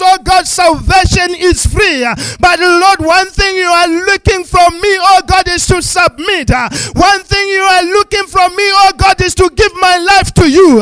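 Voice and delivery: loud, building prayer, rising to full force; fervent preaching prayer. Real, not acted.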